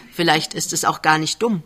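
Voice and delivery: usual speaking voice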